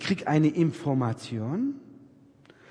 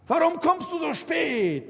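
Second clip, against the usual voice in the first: much higher accusing wife's voice; reproachful wife; 12 semitones higher than usual. Retold or imitated. Wife confronts her husband about coming home so late, high and accusing.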